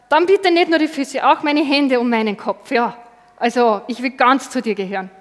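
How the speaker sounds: loud, eager plea to be washed all over; eager shout. Acted. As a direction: Peter calls out in loud eager devotion. Petrus eagerly asks for more.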